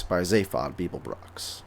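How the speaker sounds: ordinary delivery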